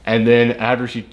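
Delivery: ordinary delivery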